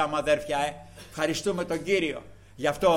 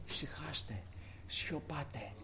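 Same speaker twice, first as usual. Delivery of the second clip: much softer, calm order to the waves; hushed command; 14 dB quieter, slower than usual. Acted. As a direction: quietly still the storm. Christ calms the sea. (ήσυχη, απαλή προσταγή)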